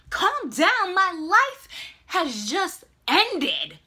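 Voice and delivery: loud, high, dramatic retort; indignant panic. Retold or imitated. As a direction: throw it back in outraged despair. Acts indignant reply to friend.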